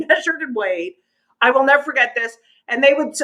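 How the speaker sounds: normal talking voice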